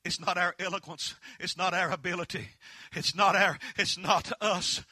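building fervent sermon delivery; preaching. Acted. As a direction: drive each "not" home from the pulpit. Preaching with rising force.

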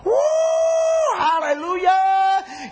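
high, drawn-out exultant shout; loud revival whoop; top-of-voice holler. Retold or imitated. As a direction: holler praise like someone overcome with joy. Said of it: "you shouted to the top of your voice".

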